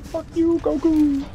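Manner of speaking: high shrieking taunt; triumphant shriek. Real, not acted. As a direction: crow over the beaten boss. Triumphant after beating boss.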